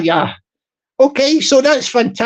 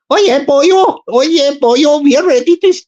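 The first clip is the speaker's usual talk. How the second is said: mock Welsh; 4 semitones higher than usual. Streamer voicing a Welsh recruit, hammed-up Welsh lilt. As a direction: ham up a Welsh volunteer, boyo. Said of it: "we call them the Taffies".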